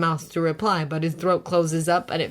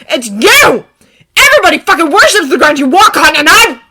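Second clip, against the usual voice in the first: angry; 13 semitones higher, 16 dB louder than usual. A narrator's reading. Bakugo shouting.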